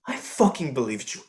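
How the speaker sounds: ordinary talk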